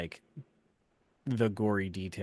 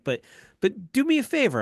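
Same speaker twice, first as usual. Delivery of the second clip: high, loud, fast exasperated challenge; retold angry rant; 10 dB louder, faster than usual. Dad challenging his daughter in a retold argument, high angry rant. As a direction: re-enact losing it, pitched way up. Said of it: "I lost my shit".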